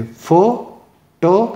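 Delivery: Photograph in three parts, FO... TO... GRAF; photograph broken into three spaced syllables. Shown as a demonstration. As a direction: slowly count out photograph, pausing after each syllable. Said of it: "उसमें तीन syllable थे".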